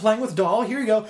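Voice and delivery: high, loud, hammed-up glee; building to a laughing high cry; gleeful mock attack. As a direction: play the king merrily hurling things at a child. Acted king hurling steel blankets.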